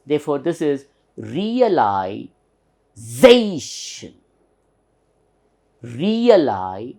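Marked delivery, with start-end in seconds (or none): 1.1-7.0 "realization" in two parts, "za" punched and long; stress on ZAY. Shown as a demonstration. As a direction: hit the "za" in "realization", stretching it. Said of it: "upper stress for this syllable".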